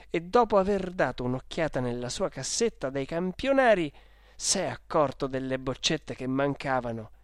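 usual speaking voice

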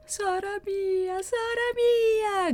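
high, slow, drawn-out crying scream; anguished cry. Acted. Maddalena realises her sister is dying, crying out. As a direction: wail her sister's name in despair. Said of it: "gridò Maddalena".

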